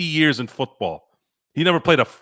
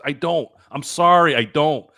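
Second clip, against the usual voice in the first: usual speaking voice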